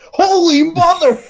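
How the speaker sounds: high, slow hammed-up yell of shock; comic high-pitched holy mother cry; mock shout. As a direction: blurt it out high and drawn out. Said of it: "he goes".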